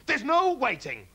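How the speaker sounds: high, loud, slow; dad refusing to wait; impatient cry. A narrator's reading. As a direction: brush him off eagerly. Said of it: "cried Mister Cranky".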